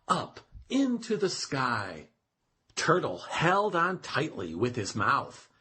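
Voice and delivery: ordinary delivery, nothing marked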